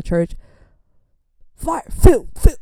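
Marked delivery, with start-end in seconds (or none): 1.5-2.6 high, loud bursts of command; mock revival prayer. Imitating minister praying down the church line, loud bursts. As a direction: fire out each word like a revival preacher.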